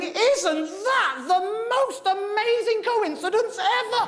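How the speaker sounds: high, slow, wide-eyed amazement; milk the coincidence, stretched out; hammed-up friend marvelling; amazed boast. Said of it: "finished it going".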